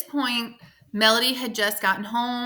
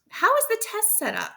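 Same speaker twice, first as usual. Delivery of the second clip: much higher, eager question; a cheery teacher quizzing her student; bright question; 12 semitones higher than usual. A narrator's reading. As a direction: ask it brightly like a keen coach. Mrs V asks brightly.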